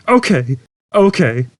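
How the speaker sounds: hurt tone. A narrator's reading. Computer sulking after Zaphod's rebuke, hurt. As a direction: sound offended and sulky. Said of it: "in a hurt tone of voice".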